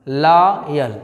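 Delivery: लॉयल as "laaa... yal"; syllable by syllable. Shown as a demonstration. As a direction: hold open "laa" in लॉयल, then detach "yal". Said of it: "आप पढ़ रहे हैं".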